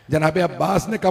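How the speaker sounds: ordinary delivery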